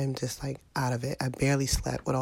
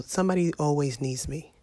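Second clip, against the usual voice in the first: normal talking voice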